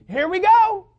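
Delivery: put-on high, loud, thrilled kid shout; fired-up little kid. Little boy on a plane before takeoff, fired up. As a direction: yell it like a kid bursting with excitement. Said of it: "he was so fired up".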